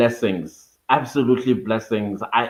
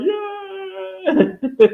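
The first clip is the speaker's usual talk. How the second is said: high squealed cheer of joy; excited cheer. Real, not acted. Streamer cheering hitting thirty thousand, high excited squeal.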